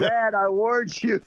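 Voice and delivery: high, slow mock warning; imitated warning daughter. Daughter scolding her embarrassing dad, drawn-out mock warning. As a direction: draw it out high, like an exasperated daughter.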